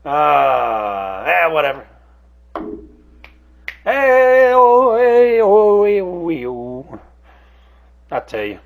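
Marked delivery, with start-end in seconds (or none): 3.8-7.0 high, loud whoops of excitement, dropping away on the final hey; giddy shouting that fades; excited hollering. Real, not acted. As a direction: call out delighted, then trail off. Excited outburst at good episode.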